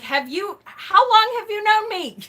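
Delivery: high, loud mock indignation; incredulous, pitch leaping up as if arguing; retold exasperated protest. Daughter retelling a retort to mother, loudly exasperated. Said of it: "I said those exact words to her".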